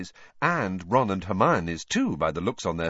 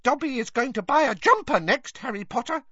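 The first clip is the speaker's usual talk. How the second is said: high, cheerful elf voice; Dobby tells Harry his plans, high and happy; happy house-elf; 3 dB louder than usual. Acted. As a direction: chirp it out with delight. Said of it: "he said happily".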